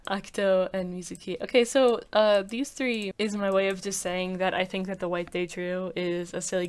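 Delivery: usual speaking voice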